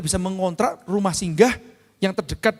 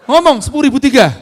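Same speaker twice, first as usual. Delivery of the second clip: much louder, high command; loud order; 6 semitones higher, 11 dB louder than usual. Retold or imitated. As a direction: bark the price at the kid. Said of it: "Saya gitu, kan". (perintah lantang kepada anak)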